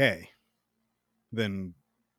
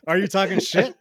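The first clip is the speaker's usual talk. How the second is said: mock suspicion; 7 semitones higher, 8 dB louder than usual. Voicing Morgan's suspicion.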